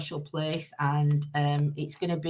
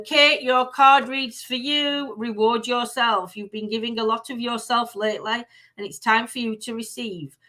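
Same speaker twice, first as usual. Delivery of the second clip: card reading; 4 semitones higher than usual. Acted. Reading Kate her tarot card aloud, measured reading voice. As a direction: read the card's message out gently, unhurried.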